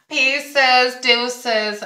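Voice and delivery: high, loud singsong brush-off; sassy sign-off. Pretend. Brushing off the rude kid with a sassy sing-song. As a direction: toss it off like a diva.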